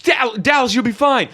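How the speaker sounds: high, frantic yelling; dad's exasperated shouting. Retold or imitated. Imitating dad telling screaming Tristan to stop, exasperated shouting.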